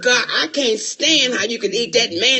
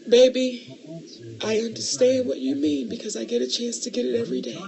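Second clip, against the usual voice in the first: ordinary talk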